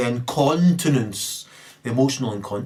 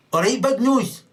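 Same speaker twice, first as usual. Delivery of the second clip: high, loud sneering jab; mocking taunt; 12 semitones higher, 5 dB louder than usual. Bully taunting someone about their nose, high and loud. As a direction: jeer it like a playground bully. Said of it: "they say".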